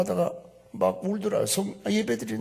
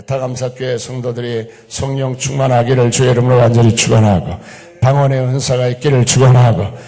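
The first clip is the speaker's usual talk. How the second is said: loud, forceful blessing; full-force preaching; 3 semitones lower, 12 dB louder than usual. Real, not acted. Preacher blesses congregation to keep God's gifts, loud and fervent. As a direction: proclaim it to the whole church, driving each phrase.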